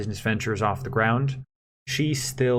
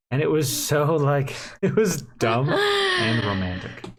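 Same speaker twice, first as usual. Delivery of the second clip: higher and slow, giggling through the words; laughing; 5 semitones higher than usual. Real, not acted. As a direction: giggle it out, fondly embarrassed. Fond laughing at memory.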